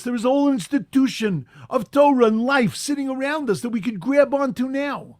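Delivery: rising fervor. Acted. Preacher urging the congregation, building to loud fervor. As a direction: preach it with growing force.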